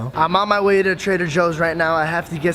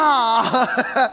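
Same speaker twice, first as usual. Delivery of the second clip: high, drawn-out mock whip cry; imitating sitcom whip sound; 5 semitones higher, much slower than usual. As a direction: stretch out a vocal whip-crack. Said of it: "It's a whip".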